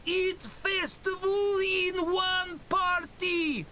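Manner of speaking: very high, drawn-out orc shout; shouting over noise. A narrator's reading. Orc explains party to confused tavern keeper, shouting over cacophony. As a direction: shout it slowly over a din, high-voiced. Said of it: "She leans close to shout over the cacophony".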